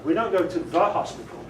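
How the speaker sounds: "the" picked out as the wrong word in "the hospital"; stress on THE. Shown as a demonstration. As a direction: lean hard on "the" to mock the extra article. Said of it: "Because we go to hospital".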